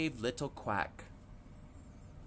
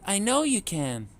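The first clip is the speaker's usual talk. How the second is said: high, louder reassurance; encouraging mother duck; 9 semitones higher, 5 dB louder than usual. A narrator's reading. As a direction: cheer the little ones on. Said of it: "Mama said".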